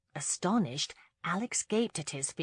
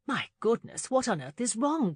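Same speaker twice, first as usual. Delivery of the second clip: mildly alarmed, raised pitch; higher, startled question; exclaiming; 6 semitones higher, 4 dB louder than usual. A narrator's reading. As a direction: exclaim with a touch of concern. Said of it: "Hannah exclaimed".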